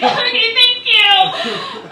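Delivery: high, loud, broken through tears; crying thanks. Retold or imitated. To the nurse after blood pressure, tearful squeaky thanks. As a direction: squeak out thanks while sobbing. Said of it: "I'm like".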